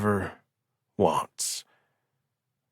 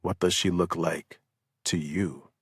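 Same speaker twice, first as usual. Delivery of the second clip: quiet echo; 2 semitones lower, 7 dB quieter than usual. A narrator's reading. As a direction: turn the question back, quietly. Larson echoes the question.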